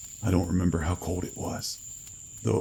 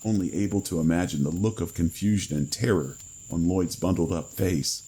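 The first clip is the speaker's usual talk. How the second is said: ordinary delivery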